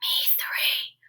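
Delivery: soft unvoiced whisper. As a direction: whisper so as not to bother rabbit. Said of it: "whispered Bear".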